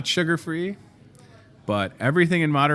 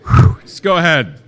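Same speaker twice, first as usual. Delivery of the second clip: mock winded; 4 semitones higher, 7 dB louder than usual. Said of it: "I'm sweating".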